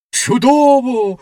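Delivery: high, loud, slow soaring exclamation; the rocket overjoyed as it shoots skyward; ecstatic cry. A narrator's reading. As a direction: cry out in delight while flying. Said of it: "вигукнула вона".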